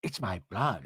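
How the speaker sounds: low, soft mimicked declaration; hushed mock-solemn line; Meredith imitation. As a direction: drop it low and quiet, mimicking her. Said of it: "I like how she goe".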